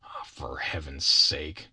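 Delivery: low, slow, long-suffering plea; pained Bill. Acted. Bill pleads with the kid on his back, low and pained. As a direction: groan it out slowly and low.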